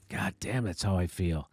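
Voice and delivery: low grumbled aside; muttered aside. Real, not acted. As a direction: drop the voice and mutter it privately. Aside after plugging the book.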